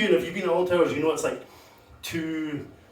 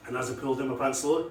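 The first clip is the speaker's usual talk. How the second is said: ordinary talk, nothing marked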